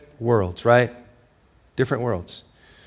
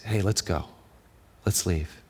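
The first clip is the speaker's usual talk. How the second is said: softly voiced girlfriend, hushed; a hushed, gentle let's leave; soft urging; 5 semitones lower, 9 dB quieter than usual. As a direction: quietly coax him out of the theater. Girl gently urges him out.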